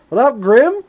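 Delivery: loud high greeting; excited greeting. Real, not acted. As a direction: call out to the dog. Greeting the dog loudly.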